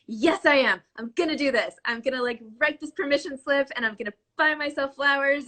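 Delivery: mock-determined, pitch lifted; fired-up self-pep. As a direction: play the newly convinced reader. Acting out fired-up reply to book.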